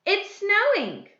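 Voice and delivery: slow, high, excited announcement; exclamation. Shown as a demonstration. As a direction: exclaim it with drawn-out delight. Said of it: "An exclamation".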